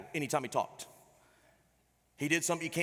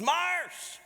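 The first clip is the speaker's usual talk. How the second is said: high, louder hallway call; coach calling out; 14 semitones higher, 5 dB louder than usual. Retold or imitated. Coach calling to a former player, high and loud. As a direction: hail an old player from down the hall.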